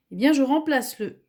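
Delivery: normal talking voice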